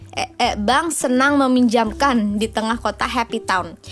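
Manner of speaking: ordinary delivery, nothing marked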